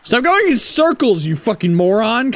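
high, exasperated yelling at the game; frustrated yell. Real, not acted. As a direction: snap at it in frustration.

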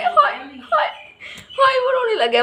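high laughing hai; amused mock sympathy. Real, not acted. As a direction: laugh through the mock pity. Mother laughs as son cries.

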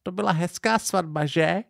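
a high, lightly voiced old lady; sleepy old woman's kindly question; old grandmother. A narrator's reading. Old woman asking the couple about the wedding, high and sweet. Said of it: "babička povídá".

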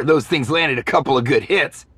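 ordinary delivery

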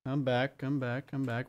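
soft repeated plea; quiet coaxing. Real, not acted. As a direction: coax it back under your breath. Coaxing a moving block back.

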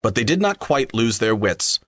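usual speaking voice

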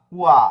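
কুয়া said out loud as a model; loud model syllable. Shown as a demonstration. Teacher building up a Spanish word aloud, loud syllable. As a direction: call out কুয়া loudly for the class. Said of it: "এখানে এরকম উচ্চারণ হবে".

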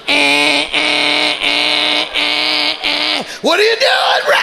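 loud, rising beeping "eh" from the watch; Fitbit alarm. Retold or imitated.

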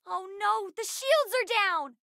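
high-pitched cry of alarm; frightened battle warning. Acted. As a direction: panic as defenses fail.

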